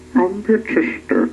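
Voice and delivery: a touch high, announcing; announcing goblin. A narrator's reading. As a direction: announce it like a little herald.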